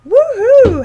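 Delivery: high, loud mock cheer; a hammed-up whoop of joy; sarcastic woohoo. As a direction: fake a big celebration. Mock cheer over small discount.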